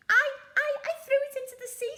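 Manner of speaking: high, loud, rushed stammer; frightened stammer. Acted. Scared troll confessing to the pirates, stammering high. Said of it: "said the Troll".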